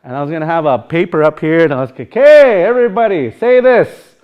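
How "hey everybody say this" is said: high, loud teasing announcement; hammed-up call to repeat; mock teacher call. I was gonna tease you guys.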